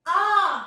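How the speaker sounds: आ loud and held, from the stomach; आ from the belly. Shown as a demonstration. Teacher modelling for pupils to repeat, आ from the belly. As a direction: push a long loud आ from the belly. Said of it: "पेट के अंदर से आवाज".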